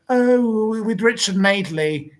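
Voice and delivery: sneering impression of a TV excuse. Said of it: "And you went".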